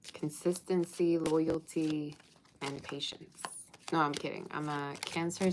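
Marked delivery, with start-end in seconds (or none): none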